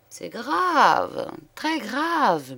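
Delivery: high-pitched, perplexed mayor; worried official pondering aloud; murmuring. A narrator's reading. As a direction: murmur it gravely on a high, drawn-out note. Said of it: "murmurait-il".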